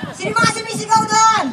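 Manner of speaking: high-pitched holler to the back; calling out to crowd. Real, not acted. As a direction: call out over the audience. Calling out to the audience.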